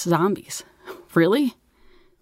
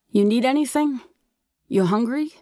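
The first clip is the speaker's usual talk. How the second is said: high-pitched questioning call; 7 semitones higher than usual. A narrator's reading. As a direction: call an offer down to mom. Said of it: "I called down asking".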